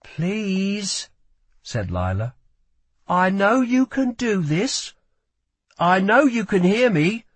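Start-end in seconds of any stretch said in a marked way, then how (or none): 0.0-1.1 pleading. A narrator's reading. Lila pleading softly. 3.1-7.2 pleading. A narrator's reading. Lila urging Kel gently.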